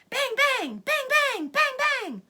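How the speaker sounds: shouted gunshots. A narrator's reading. Narrator shouts the farmers' gunshots at the fox, loud. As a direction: blast out each bang like gunfire. Guns firing in story.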